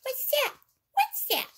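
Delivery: squeaky toy question. Acted. Toy asks repeatedly.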